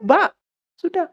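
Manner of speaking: calling out to get attention; retold shout. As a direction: call across to the attendant, exasperated. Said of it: "Sampai saya teriak".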